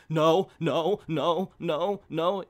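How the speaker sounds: acted-out protest, each no punched loud; mock protest. Reviewer voicing the watching hero, mock protesting. Voices Wolverine's imagined reaction.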